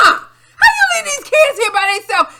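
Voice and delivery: high, loud exasperated yelling; shrill-free loud pleading yell; retold yell at mom. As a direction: scold her like she lost her mind. Retelling shouting at mother.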